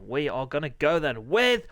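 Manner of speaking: high, rising race intro; race announcer. Acted. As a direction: announce the race with mounting energy.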